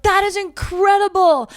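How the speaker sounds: high, loud wide-eyed awe; childlike awe. Retold or imitated. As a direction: gush like a kid seeing the dunk team. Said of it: "I was like".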